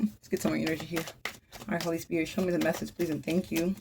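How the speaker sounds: soft, low prayer to the Holy Spirit; hushed request for guidance; quiet invocation. Real, not acted. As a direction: ask the spirit softly, almost to yourself.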